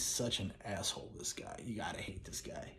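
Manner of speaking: whisper. Real, not acted. Disgust at the film villain.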